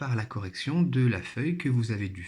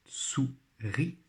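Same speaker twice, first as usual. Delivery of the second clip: "Souris" split into sou, pause, ris; syllable by syllable; 3 dB quieter than usual. Shown as a demonstration. Teacher helping pupils find the syllable, syllable by syllable. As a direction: sound out "Souris" slowly in two beats. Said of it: "Toujours première syllabe".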